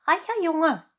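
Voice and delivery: high, loud cat echo, fully voiced; whispering cat. A narrator's reading. Cat echoes the fox's promise to Pinocchio, high-pitched. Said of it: "flüsterte die Katze".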